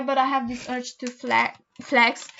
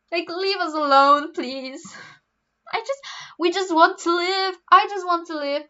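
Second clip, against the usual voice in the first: mock plea; 6 semitones higher than usual. Mock plea to judging classmates.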